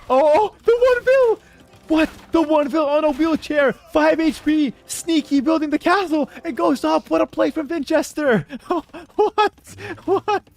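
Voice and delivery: very high, loud, stunned yelling; hype scream. Real, not acted. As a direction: scream in disbelief at the play. Surprise castle play, caster erupts.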